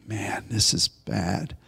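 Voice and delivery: low, hushed, slow aside; quiet dismay. Acted. Voicing how easy it is to despair, quiet dismay. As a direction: say it under your breath, slowly. Acted quote of a discouraged reaction.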